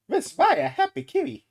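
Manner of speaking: put-on high, loud squeal of a little girl. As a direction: chirp it like an excited child. Voicing a little girl character.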